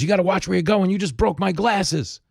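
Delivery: high-pitched, indignant hustler rant; hustler's mock screaming. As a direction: act out a scammer wailing over broken glasses. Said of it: "and starts screaming again".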